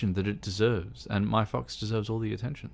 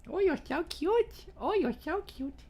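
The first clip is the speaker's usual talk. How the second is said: high squeaky pet voice. Real, not acted. Talking sweetly to her pet fox.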